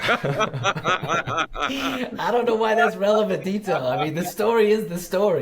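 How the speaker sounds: high, talking through laughter; laughing. Real, not acted. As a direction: joke while still laughing.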